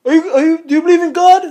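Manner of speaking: high, loud, funny comedian voice; exaggerated high-pitched celebrity impression; Tracy Morgan. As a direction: squawk it out as a comic impersonation. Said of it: "he talks really funny".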